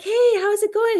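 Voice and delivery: high, breezy hello; cheery greeting. Retold or imitated. Mom checking on her son, cheery high greeting. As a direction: imitate a bright check-in, pitched well up.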